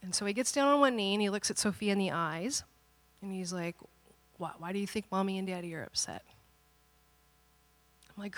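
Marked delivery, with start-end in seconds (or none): 4.4-6.2 soft, patient questioning; gentle father. Retold or imitated. Father kneeling before his daughter, gently asking. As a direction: ask a child gently, voice lowered.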